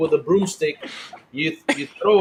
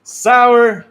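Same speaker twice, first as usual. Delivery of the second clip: loud call; 5 semitones higher, 9 dB louder than usual. Real, not acted. Calling co-host loudly.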